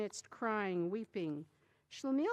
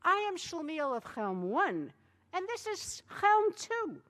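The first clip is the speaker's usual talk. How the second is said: Schlemiel's voice; 9 semitones higher, 4 dB louder than usual. A narrator's reading. Schlemiel insists.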